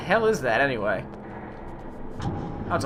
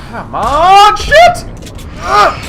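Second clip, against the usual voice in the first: frustrated yell; 10 semitones higher, 11 dB louder, much slower than usual. Real, not acted. Out of ammo, attacked.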